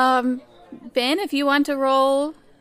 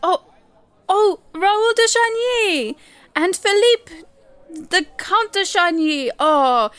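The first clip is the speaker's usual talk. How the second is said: high, loud, gushing greeting; flustered fawning host, voice raised; effusive theater manager; 5 semitones higher, 4 dB louder than usual. Acted. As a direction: fuss over important patrons.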